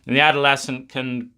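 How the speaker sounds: ordinary talk, nothing marked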